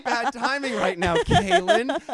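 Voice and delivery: high, loud angry rant; angry yelling. Retold or imitated. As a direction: rant at her furiously. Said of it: "you know how I never get angry".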